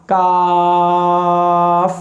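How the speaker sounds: काफ drawn out very slowly, thin and light; light kaf. Shown as a demonstration. Teacher to students, modelling kaf, light and slow. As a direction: hold काफ long without a y-glide. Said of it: "ये बारीक पढ़ा जाएगा".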